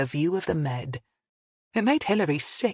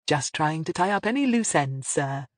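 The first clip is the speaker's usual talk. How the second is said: usual speaking voice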